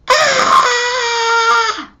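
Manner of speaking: high, long, loud scream; a child's voluntary scream; imitated scream. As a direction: scream with all her might. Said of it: "She was screaming".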